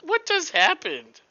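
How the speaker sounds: high, loud stunned question; shocked disbelief. Real, not acted. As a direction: ask it in disbelief. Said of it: "first take".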